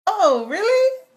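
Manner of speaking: high loud indignant oh really; shocked oh really. Acted. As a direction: react like you just caught the insult. Shocked realisation of the insult.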